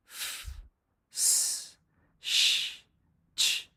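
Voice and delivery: breathy unvoiced f, s, sh, ch; hissed voiceless fricatives; voiceless hiss sounds. Shown as a demonstration. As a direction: push air out with no voice. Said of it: "For example".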